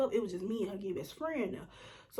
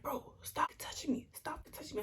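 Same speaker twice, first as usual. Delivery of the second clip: hushed warning; 5 semitones higher, 6 dB quieter than usual. Retold or imitated. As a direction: hiss it quietly so only she hears. Retelling her warning to the girl.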